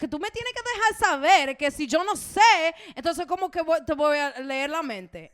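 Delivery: furious Dominican Spanish tirade; angry Dominican Spanish rant. Retold or imitated. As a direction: tell him off, full Dominican attitude. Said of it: "I go Dominican Spanish".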